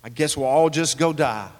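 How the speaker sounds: mock-resigned doubter; dry, sarcastic giving-in. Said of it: "He's sarcastically saying".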